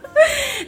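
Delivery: laugh. Real, not acted. Laughs at being teased.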